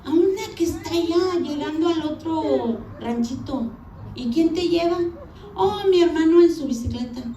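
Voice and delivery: high, lively back-and-forth quotes; animated retold chat. Girl answering her suitor's questions about school, high and animated. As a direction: voice both sides high and animated.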